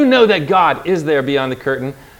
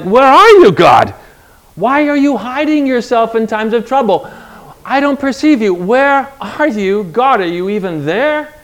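high, loud pleading cry that settles into aching questions; desperate plea to God; 7 semitones higher, 4 dB louder than usual. Retold or imitated. Preacher voicing the psalmist crying to a hidden God, pleading. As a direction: cry out to God like a believer who feels abandoned. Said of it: "the psalmist is like".

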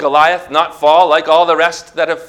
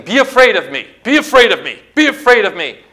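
higher, louder acted intimidation; shouting Goliath; 6 semitones higher, 4 dB louder, faster than usual. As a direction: hurl it as a repeated threat. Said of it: "comes against you shouting".

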